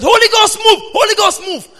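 high, loud preacher's cry; shouting minister. Retold or imitated. Preacher imitates the minister crying out to the Holy Ghost, loud and high. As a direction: call on the Spirit like a fiery minister.

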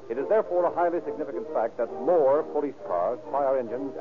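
usual speaking voice

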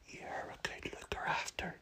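soft unvoiced whisper. A narrator's reading. Grandma whispering thanks to the boy. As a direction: breathe it like a secret. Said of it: "she whispered".

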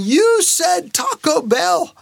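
slow, high-pitched mock indignation; incensed kids. Kids together hold dad to his word, mock incensed. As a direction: imitate kids' high, drawn-out protest. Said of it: "Their voice is inflamed by what my promise was".

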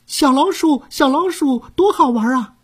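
put-on very high, gleeful little boy; delighted child. A narrator's reading. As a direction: clap and squeal with joy. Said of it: "他高兴极了". (高兴的小孩声音)

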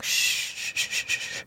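fading shush. Pretend. Head on his neck, calming him: fading whispered shush. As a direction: let the hush die away to nothing. Calming partner in her arms.

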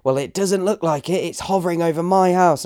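high mimicked objection; complaining neighbour. Imitating the woman arguing about the drone, high complaining voice. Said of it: "And she goes".

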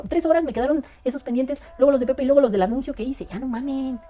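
high, fed-up mock complaint; exasperated rant. Replying to a fan about the unread comments, exasperated and high. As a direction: throw up your hands at the pile of comments.

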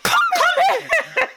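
very high, stretched-out cry; squealed summons, half laughing; mock frantic calling. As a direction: call the child over in comic panic. Mum calls child while fleeing.

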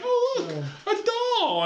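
put-on very high, sing-song childish delight; delighted little sister. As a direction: coo like a little girl finding a doll. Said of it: "Suzanne be like".

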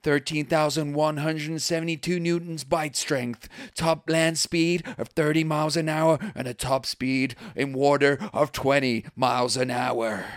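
slow, drawn-out stats like a fight announcer; mock ring announcer reading out fighter stats. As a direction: announce the contender's stats to the arena. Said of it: "future as a ring announcer".

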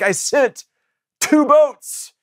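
high-pitched comic punchline, pausing before the last words; mock exasperation. God answers the drowned man, mock exasperation.

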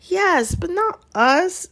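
high-pitched, drawn-out exasperation; whiny exasperated objection. A narrator's reading. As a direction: stretch it out, pitch shooting up in protest. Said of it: "said Ron in exasperation".

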